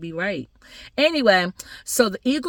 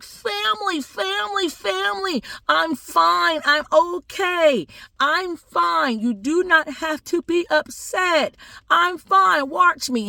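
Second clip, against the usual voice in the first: put-on high little boy voice; calm the family down in a high, insistent child voice; reassuring little boy; 11 semitones higher, slower than usual. Little boy telling his family he's fine, high and insistent.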